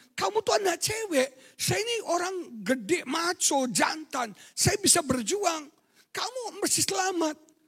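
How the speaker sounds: high put-on voice for the burly man; mocking squeaky-voiced macho man; big macho man. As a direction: play the tough man's words in a squeaky mock voice. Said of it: "Bapak ini bilang".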